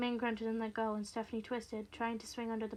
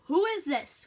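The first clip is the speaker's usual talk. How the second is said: loud, higher irritated demand; irritable phone caller, raised voice; more irritable; 6 semitones higher, 12 dB louder, slower than usual. Acted. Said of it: "more irritably this time".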